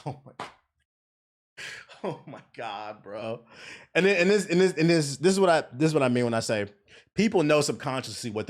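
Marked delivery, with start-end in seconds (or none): 0.0-3.4 hushed, drawn-out exasperation; quiet disbelief. Retold or imitated. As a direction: say it low and slow, half laughing in dismay. Storyteller dismayed at the driver's answer.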